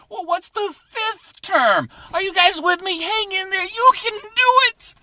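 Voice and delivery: high-pitched, shouted rallying cry; over-the-top classroom cheerleading; loud pep talk. Pretend. Teacher rallies the class loudly.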